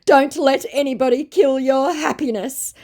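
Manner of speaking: hammed-up wise old man; mock profound scammer. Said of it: "he said with great profundity".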